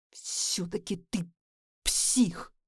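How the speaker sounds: hissed through clenched teeth; hissing. Acted. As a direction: hiss it with contempt. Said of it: "шипит Джеймс".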